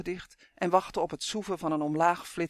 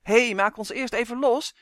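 loud, higher urgent call; shouted protest; 5 semitones higher, 6 dB louder than usual. A narrator's reading. Captive calls to departing twins, shouted. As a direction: call after people running off. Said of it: "schreeuwde Justin nog".

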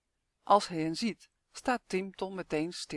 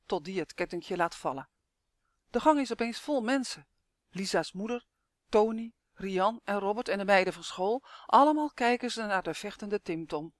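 plain reading voice

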